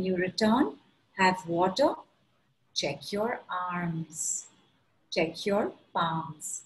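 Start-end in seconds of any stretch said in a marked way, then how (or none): 2.7-6.6 slow, soft guiding voice; guided meditation. Acted. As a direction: gently guide attention, slowly.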